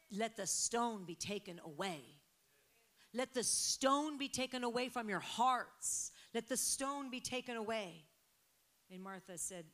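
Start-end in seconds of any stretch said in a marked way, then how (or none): 3.1-8.1 louder, higher proclamation; loud decree. Real, not acted. Preacher to congregation, loud proclamation. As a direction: proclaim it over the church.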